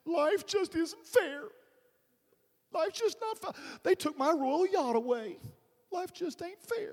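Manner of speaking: high, loud mock whining; hammed-up royal self-pity, drawn out; Prince Charles. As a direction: wail it like a pampered prince. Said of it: "can you imagine Prince Charles?".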